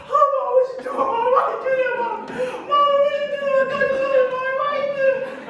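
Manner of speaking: very high, loud whiny protest; outraged little eagle. Acted. Baby eagle protesting to mama after the drop, very high and loud. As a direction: complain like a shaken child.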